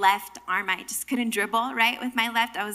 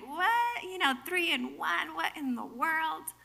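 high-pitched bewildered "what?"; puzzled, thrown by a baffling idea; baffled; 4 semitones higher, 7 dB quieter, slower than usual. Acted. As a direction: pitch jumps up in confusion. Puzzled reaction to the Trinity.

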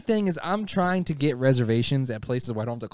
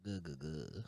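awkward murmur; 10 semitones lower, 21 dB quieter, slower than usual. Retold or imitated. Retold awkward reply.

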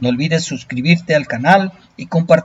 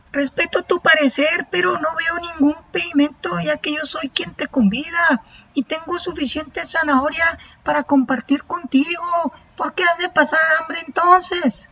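high, small bunny voice; little rabbit voice. Acted. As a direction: play a tiny friendly rabbit, pitched way up.

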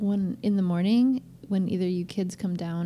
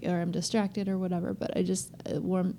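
usual speaking voice

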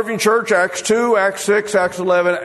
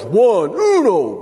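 slow spelled-out count; much slower than usual. Shown as a demonstration. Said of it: "very specific with him".

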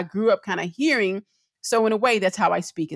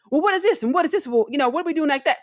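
high-pitched, excited re-enactment; voice going up; 9 semitones higher, much faster than usual. Retold or imitated. Wife coming home to talk to husband, excited raised pitch. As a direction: let the pitch shoot up as you re-enact excitement. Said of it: "my voice does elevate to a higher pitch".

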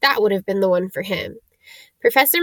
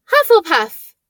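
higher, louder house announcement; hat's call; 7 semitones higher, 5 dB louder than usual. Acted. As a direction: proclaim the house to the hall.

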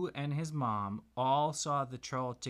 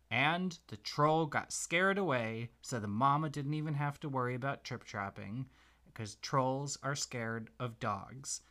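plain reading voice

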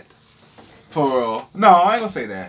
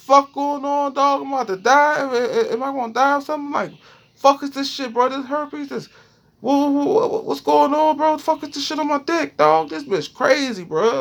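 high, frantic squeal of panic; shrieking in alarm at what he sees; panicked freakout; 13 semitones higher than usual. Retold or imitated. A man staring at a rash in panic, high frantic squeal.

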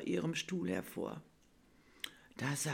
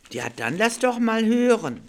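higher, much louder cheerful invitation; jolly Nikolaus; 6 semitones higher, 12 dB louder than usual. Acted. Nikolaus inviting Bella to recite, louder and jolly. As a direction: boom out a friendly invitation.

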